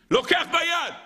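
high, sudden outburst; amazed exclamation. Real, not acted. Preacher stunned that Moses grabs the coals, exclaiming. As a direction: burst out high on the climax.

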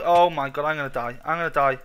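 panicked. Real, not acted. Panicking at a mob attack.

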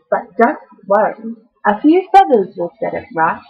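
ordinary delivery

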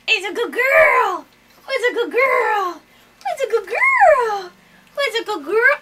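shower the bird with praise; gushing praise. Real, not acted. Praising bird during ear drops.

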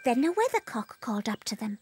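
plain reading voice

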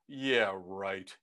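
low, slow deadpan disbelief; dismissive mother. Retold or imitated. Mother dismisses his request.

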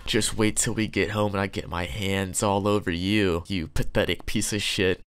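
low, quiet menacing threat; a hint of a creepy parent. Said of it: "creepy ass undertone".

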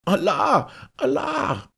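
high, loud wail to heaven; panicked cry. A narrator's reading. Merchant accused of perjury before the Caliph, panicked cry. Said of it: "rief jener".

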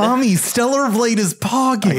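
childish mock voice. Childish joke voice.

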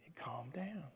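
sudden near-whisper after the outburst; hushed answer. Acted. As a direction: drop right down to a hushed whisper. Hushed answer after shouted question.

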